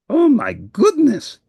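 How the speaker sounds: high, slow, flustered Oh my goodness; startled cry. Acted. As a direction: gasp it out like a monster who has crashed into a friend. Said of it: "cried Grover".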